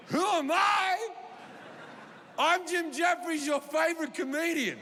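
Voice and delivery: high indignant shout, slowed; mock indignant shout. Comic shouting his name at a stranger who doesn't know him. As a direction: announce yourself like a star. Acted retort at confused man.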